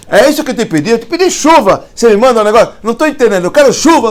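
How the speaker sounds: very high and loud, outraged; indignant shout. Retold or imitated. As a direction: complain like a spoiled kid. Sage demands rain again.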